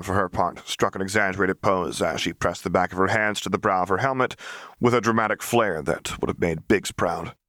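plain reading voice

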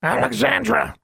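very high squawked name; parrot-like shriek of a name; loud raven call. Acted. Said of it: "Charlie loudly called".

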